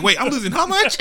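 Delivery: high, laughing disbelief; shocked. Retold or imitated. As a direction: shriek it in disbelief. Quoting Mike.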